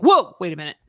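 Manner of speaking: sudden loud whoa; acted startled reaction. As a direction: burst out as if caught off guard. Character startled by child speaking.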